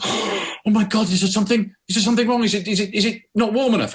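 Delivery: a flustered waiter fearing he ruined the dish; horrified waiter. Retold or imitated. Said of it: "the waiter is horrified".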